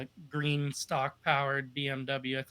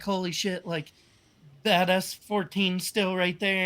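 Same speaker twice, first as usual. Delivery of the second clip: excited disbelief; 4 semitones higher, 5 dB louder than usual. Retold or imitated. Teammate shocked after tandem.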